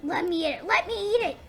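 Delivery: high-pitched, rising to a loud cry; excited play demand. Pretend. Hungry toy chasing the chicken ghost, excited and high. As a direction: build from eager question to high, loud command.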